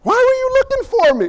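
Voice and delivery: put-on very high, boyish innocent question; a child asking with no guile; high, sweet "why were you looking for me". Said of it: "fully respectfully, says".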